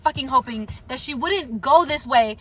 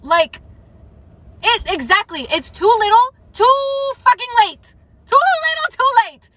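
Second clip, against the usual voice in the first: much higher and louder, spitting each word; furious rant; 8 semitones higher, 5 dB louder than usual. Real, not acted. Vlogger raging at Adian's late regret, furious and loud. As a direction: hammer "too little, too late" in real outrage.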